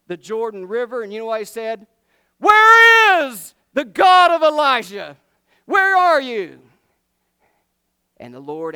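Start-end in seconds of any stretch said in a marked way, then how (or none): 2.4-6.6 very high, loud, drawn-out cry to heaven; full-throated shout. Acted. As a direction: bellow a desperate challenge up to God. Acts Elisha calling on God.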